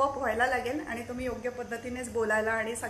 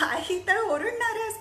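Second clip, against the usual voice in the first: laughing; 9 semitones higher, 4 dB louder, slower than usual. Real, not acted. Mom will yell anyway, laughing.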